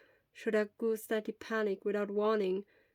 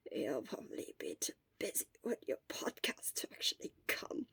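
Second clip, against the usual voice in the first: a weak teasing whisper; hoarse mocking whisper; 10 dB quieter than usual. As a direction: tease him with what little voice is left. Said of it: "Shota mocked hoarsely".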